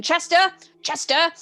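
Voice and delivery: high, loud call across a room. Acted. Librarian summons his assistant, calling out.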